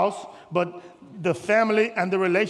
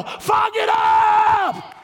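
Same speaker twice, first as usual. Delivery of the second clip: much higher, very slow shout of fog it up; very high drawn-out shout; 12 semitones higher, 5 dB louder, much slower than usual. Acted. Pastor ridiculing the excuse, high slow shout. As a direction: stretch it out high and loud. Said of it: "I said F O G it up".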